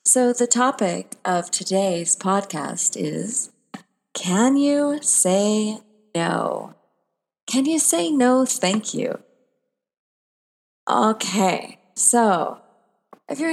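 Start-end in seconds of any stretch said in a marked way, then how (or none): 4.1-6.8 a slow, weighty question; slow and drawn out. Acted. Asks the title question, drawn out. 7.4-9.2 playful, bright challenge; higher, brighter question. Acted.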